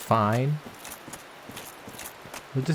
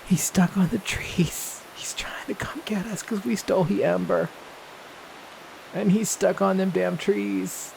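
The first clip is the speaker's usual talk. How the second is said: amused whisper; 6 semitones higher than usual. Real, not acted. Player whispering to viewers about the stuck mushroom. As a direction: hush it like a secret.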